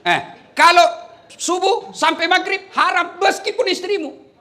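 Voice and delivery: normal talking voice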